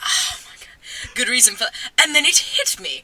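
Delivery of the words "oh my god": whispered oh my God; whispered aside to herself. Real, not acted. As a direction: breathe it out without voice.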